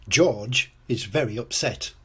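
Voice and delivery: usual speaking voice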